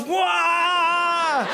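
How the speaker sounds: high shouted wah; mock crowd cheer. The pastor imitates students cheering Sachi's sermon with a high shouted wah.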